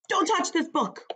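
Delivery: high-pitched, quick warning to hands off; playful warning. Acted. Book character warns the child reader, high-pitched warning. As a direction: voice jumps up to warn the reader off.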